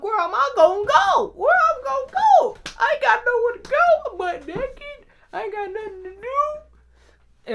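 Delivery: very high comic pleading; high-pitched desperate wail. Retold or imitated. Naked teen with nowhere to go, high wail.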